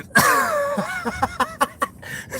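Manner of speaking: squealing falsetto laugh; high laugh. Real, not acted. Streamer cracking up at chat, high squealing laugh. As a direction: let out a high, shrieking laugh.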